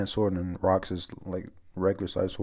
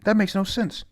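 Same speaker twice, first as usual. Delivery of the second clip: high indignant Gloriosa; 11 semitones higher, 4 dB louder than usual. Retold or imitated. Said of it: "Gloriosa says".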